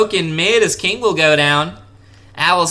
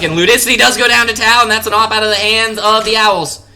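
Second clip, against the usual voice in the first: excited; 4 semitones higher, 4 dB louder than usual. Real, not acted. Kill called live.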